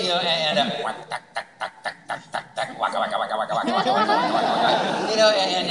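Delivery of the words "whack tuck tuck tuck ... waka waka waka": mock game sound effects, waka waka; arcade game noises. Listing the game sounds he knows, mock chomping. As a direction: chomp like Pac-Man with a laugh. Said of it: "I don't know anything about video games".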